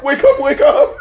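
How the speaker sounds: high, urgent mock plea with laughter; imitated frantic wake-up. Listener echoing voices waking her, imitated frantic urgency. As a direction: laughingly act out an alarmed wake-up call. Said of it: "starts getting darker and darker".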